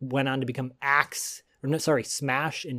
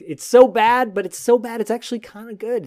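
excited; 9 semitones higher, 8 dB louder than usual. Real, not acted. Excited about bad accent.

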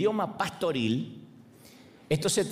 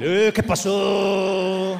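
loud, very slow, higher laughing shout; mock outraged shout; 6 semitones higher, 8 dB louder, much slower than usual. Mexican told not to eat spicy food, loud slow mock shout. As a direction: roar it back like a Mexican offended.

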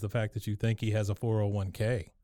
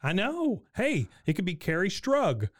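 high voice; 6 semitones higher than usual. Retold or imitated. Retold Bobby, much higher.

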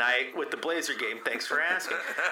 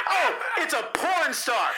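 shocked realization; 7 semitones higher than usual. Retold or imitated. Shocked discovery in retold search.

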